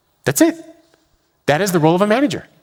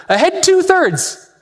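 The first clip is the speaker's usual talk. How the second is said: much higher, slow echoed order; navigator repeating; 12 semitones higher, much slower than usual. Retold or imitated. Navigator repeating the captain's order, high slow echo. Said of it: "the navigator repeats the order".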